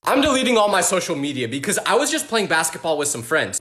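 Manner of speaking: usual speaking voice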